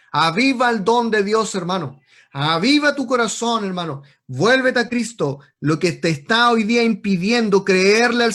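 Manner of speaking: loud, forceful exhortation; urgent, ringing pulpit call; fiery preaching. Real, not acted. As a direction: preach it at full force to the congregation.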